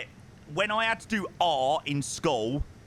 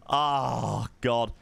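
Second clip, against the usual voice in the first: normal talking voice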